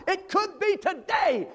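drawn-out high cry of it could be today; slow high call. Real, not acted. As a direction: stretch each word up high. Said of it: "I said it could be today".

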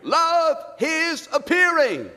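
high, slow, drawn-out appearing; slow emphatic answer. Acted. Preacher answering his own question, slow and lifted. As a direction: draw out Love his appearing. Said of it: "Love what?".

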